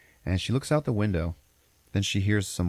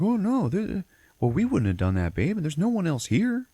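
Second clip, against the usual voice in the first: high, swooping hammed-up denial; mock protesting husband; 12 semitones higher than usual. Teller voices husband denying he locked her in, mock protest. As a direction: protest innocence with exaggerated high leaps.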